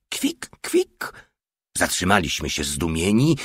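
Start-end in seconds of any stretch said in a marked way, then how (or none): 0.1-1.1 very high, airy little squeals; pig squeal. A narrator's reading. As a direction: squeak it like a piglet. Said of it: "dziwnie pochylonego i kwiczącego cały czas".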